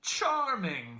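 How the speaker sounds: high, sweet fussy delight; finical, sweet. A narrator's reading. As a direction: savor the word daintily. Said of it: "in a finical, sweet voice".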